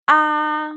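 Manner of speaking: "Ah" held high and level; first tone. Shown as a demonstration. Teacher drills the first tone, high and level. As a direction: hold "Ah" high.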